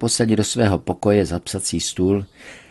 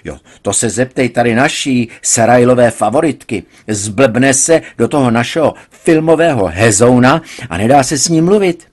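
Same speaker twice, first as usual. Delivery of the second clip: loud, higher, sarcastic; angry complaint; 3 semitones higher, 6 dB louder than usual. A narrator's reading. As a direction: vent to his wife about the daughter. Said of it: "proč křičíš".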